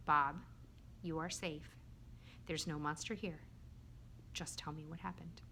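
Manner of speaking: soft, low soothing voice; calm reassurance. Acted. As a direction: reassure a scared friend, softly and gently. Soothing frightened Bob.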